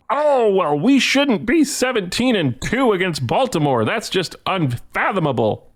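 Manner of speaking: high, mocking whiny announcer voice; mock Yankees announcer. Mocking rival announcers, high mock whine. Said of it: "I didn't hear Yankees announcers going".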